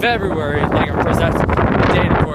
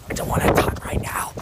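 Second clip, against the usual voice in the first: hushed whisper so no one hears. Real, not acted. Kid vlogging outside whispers to hide from people nearby. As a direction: whisper it, hiding from passersby. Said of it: "I think it's safe to talk".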